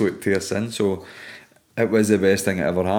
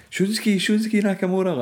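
imitating the tourists; 12 semitones higher than usual. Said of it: "they were saying".

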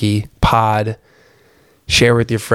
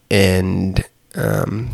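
usual speaking voice

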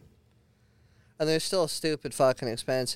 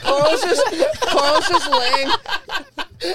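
laughing hard; 8 dB louder, much slower than usual. Real, not acted. Cracking up over Carl's swim.

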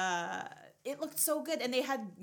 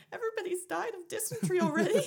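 surprised; 11 semitones higher, 5 dB louder, faster than usual. Retold or imitated. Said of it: "I was like".

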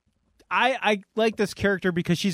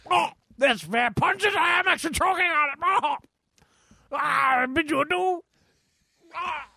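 hammed-up choking; 10 semitones higher than usual. Pompous figure losing to Stoolie Steve, hammed-up high shout. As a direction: overplay the defeat, shouting.

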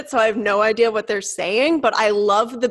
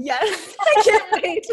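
laughing; 8 semitones higher, much slower than usual. Real, not acted. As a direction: burst out laughing on the words. Real laughing at promised singing.

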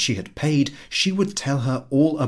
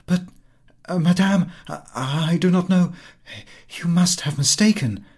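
flustered, louder and higher stammer; 7 semitones higher, 5 dB louder than usual. Acted. Madame Forestier, not recognising the poor woman, stammering higher and louder. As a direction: stumble over the words, confused. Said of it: "and stammered".

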